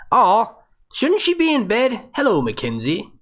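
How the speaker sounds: high, slow doting voice; tender aw. Real, not acted. Sweet reaction to a little girl.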